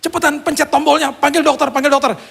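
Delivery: high-pitched panicked wife; wife's yell. Retold or imitated. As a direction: cry out urgently for help. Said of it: "istri saya teriak".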